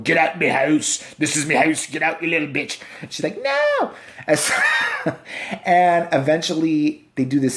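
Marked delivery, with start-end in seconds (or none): 0.0-2.8 put-on cranky old British man; hammed-up old codger ordering them out; mock old grandpa. Old grandpa demon in the corner, mock cranky old-man voice. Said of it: "some old grandpa in the corner of the room going". 3.4-3.9 very high squeaked refusal; mock little girl. Little girl answering the grandpa demon, high mock squeak.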